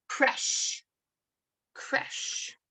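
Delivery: "creche" as KRESH, nearly whispered; French word. Shown as a demonstration. Child showing off a learned French word, soft pronunciation. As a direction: say "creche" softly, one syllable, long sh. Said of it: "You can say a French word".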